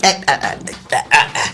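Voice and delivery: low breathy laughter; ah-ah-ah laugh, breaking up; giggling helplessly at the joke. Real, not acted. Laughing fit after teasing.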